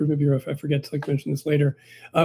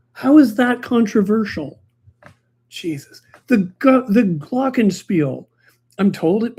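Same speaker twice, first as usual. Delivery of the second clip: exasperated; 6 semitones higher, 4 dB louder than usual. Real, not acted. Exasperated rant about the controversy.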